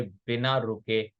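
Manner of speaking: ordinary talk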